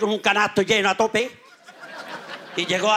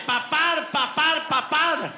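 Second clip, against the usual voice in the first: put-on whining child; 3 semitones higher than usual. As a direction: beg like a schoolboy who won't go. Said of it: "Le dice el niño al padre".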